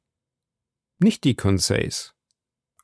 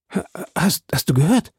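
breathless, higher-pitched stammer; 7 semitones higher than usual. A narrator's reading. Exhausted swimmer asking his companion, stammering. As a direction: stumble over the first word, straining to ask. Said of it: "stammelte ich".

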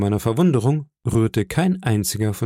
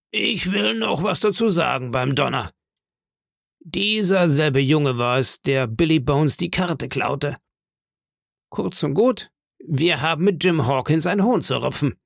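accusing pirate; 5 semitones higher than usual. Acted.

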